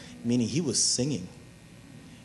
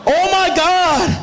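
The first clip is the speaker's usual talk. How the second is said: high, shouted sermon delivery; loud, sustained preacher's cry; full-force preaching; 13 semitones higher, 9 dB louder than usual. Real, not acted. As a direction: work the congregation up at full volume.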